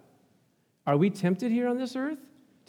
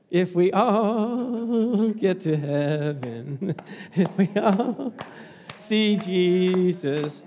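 drawn out, hushed wonder; slow reverent musing; slow, dreamy awe; 2 semitones lower, much slower than usual. Real, not acted. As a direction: linger on each word like a vision. Dreamy picture of heaven.

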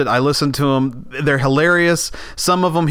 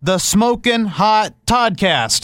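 slow prompting; 6 semitones higher, slower than usual. Shown as a demonstration. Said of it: "feeding the line".

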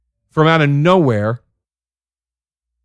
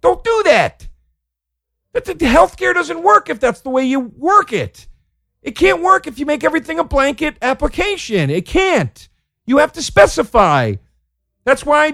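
exasperated rant; 11 semitones higher than usual. Real, not acted. Comedian ranting at a doctor, high exasperated outburst. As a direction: vent at him like he's in the room.

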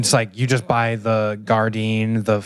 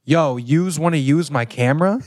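Philly local offering help, rising at the end; Philly accent; 5 semitones higher than usual. Retold or imitated. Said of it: "funniest thing I ever heard from Philly".

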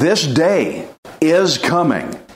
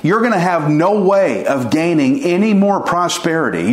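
ordinary delivery

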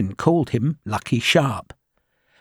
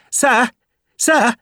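much higher, louder anxious officer calling out; eager young lieutenant; 11 semitones higher, 4 dB louder than usual. Acted. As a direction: call out breathlessly to the major.

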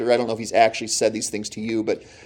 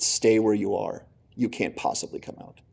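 a touch of a hushed devil; 3 semitones lower, 8 dB quieter than usual. Retold or imitated. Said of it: "the devil will say".

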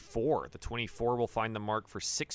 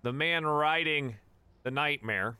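ordinary talk, nothing marked

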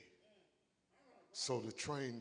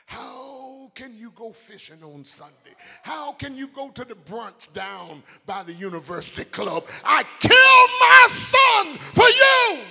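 starts raised, builds to a huge high shout; building God's lament; 12 semitones higher, 26 dB louder than usual. Acted. God pleading with empty church, building to a shout. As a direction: climb from plea to full roar.

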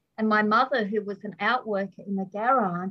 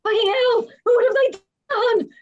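very high, loud exasperated cry, rising as it goes; an outraged parent cursing the books; mock outraged parent; 12 semitones higher, 8 dB louder than usual. Parent blaming books for baby's fall, mock shout. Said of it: "so it's like".

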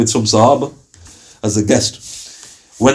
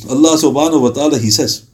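ordinary delivery

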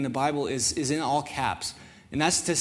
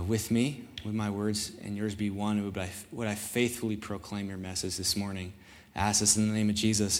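prayer voice; 4 semitones lower than usual. Acted. Leads a prayer.

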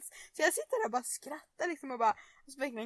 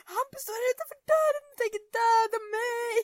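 imitating a frantic, much higher cry to mom; panicked call; 11 semitones higher, 5 dB louder than usual. Big sister calling mom from the toilet, mock panic. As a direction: squeal it out like she's about to be killed.

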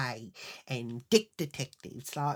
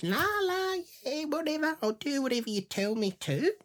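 high, airy put-on voice; spaced-out compliance; 11 semitones higher than usual. Woman going along with the men on tape, in a high sing-song mock voice. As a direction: mimic a dazed pushover, pitched way up.

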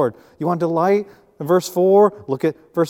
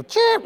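very high, piercing bird chirp; chirp scream; 3 dB louder than usual. Retold or imitated. Preacher imitating the ticked-off bird pinned in the dustpan, chirp screaming. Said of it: "if you've heard chirp screaming".

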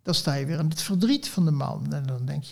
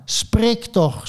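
higher, louder narrated shout; storyteller's raised, insistent yell; shouted demand; 5 semitones higher, 5 dB louder than usual. A narrator's reading. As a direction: shout it in exasperation, pitched up. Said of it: "schreeuwde ik".

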